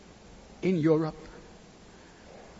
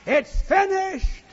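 much higher, louder, slow ringing cry; jubilant proclamation; 8 dB louder, slower than usual. Acted. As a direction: proclaim it in triumph to the angels. Clouds tell the angels, climax.

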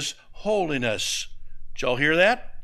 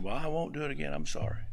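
meek apology; 7 dB quieter, faster than usual. Retold or imitated. Apologizing meekly to husband.

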